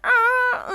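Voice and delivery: high mock scream of the band; short hammed-up emo scream. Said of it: "they start screaming that".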